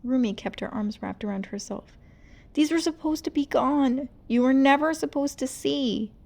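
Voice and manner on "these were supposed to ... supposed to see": distressed. Acted. Rumi exposed and ashamed.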